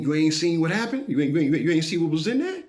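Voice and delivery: can't believe he hasn't heard; incredulous question. Retold or imitated. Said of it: "And I'm like".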